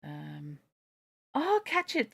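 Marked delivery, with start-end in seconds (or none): 1.3-2.1 sudden high cry of alarm. A narrator's reading. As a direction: cry out as the bird nears the flame. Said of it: "cried the lady".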